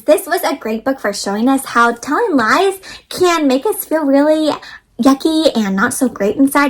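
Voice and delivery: ordinary delivery, nothing marked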